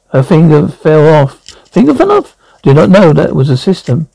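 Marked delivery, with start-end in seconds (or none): 1.7-2.4 shocked disbelief. Acted.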